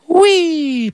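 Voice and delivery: high sung-out wee; gleeful wee. Retold or imitated. Swinging the rod out over the stream, gleeful. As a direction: cheer the cast. Casting the line.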